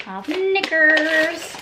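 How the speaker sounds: milk the word knickers for a laugh; comic reveal. Pretend. Announcing a knicker delivery to viewers, comic drawl.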